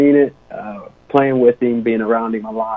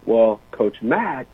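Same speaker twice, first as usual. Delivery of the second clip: ordinary talk, nothing marked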